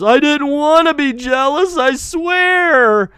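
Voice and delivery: high, loud, whining mock Batman; whiny mocking Batman. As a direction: whine it like a sulking kid. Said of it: "Batman looks at her and goes".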